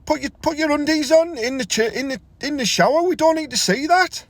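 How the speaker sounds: high, outraged scolding; mock telling-off. Lad scolds naked old man in shower, high outraged scold. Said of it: "I was, oh".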